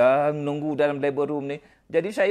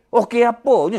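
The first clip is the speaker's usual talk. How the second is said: louder sharp scold to the wife; glaring scolding; 4 semitones higher, 8 dB louder than usual. Retold or imitated. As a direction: snap it back like a stern husband. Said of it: "Saya berjegil macam tu".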